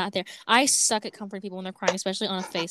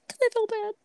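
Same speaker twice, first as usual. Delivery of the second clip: high-pitched mock-tearful voice; small, high, pitiful imitation; imitating a crying friend; 12 semitones higher than usual. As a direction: mimic your friend whimpering an excuse. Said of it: "And you were like".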